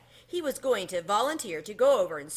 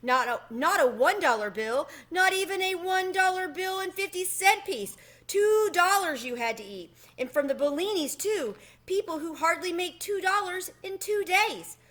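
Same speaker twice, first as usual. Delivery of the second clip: dismayed mouse; 9 semitones higher, 3 dB louder than usual. Acted. Tucker laments the lost money.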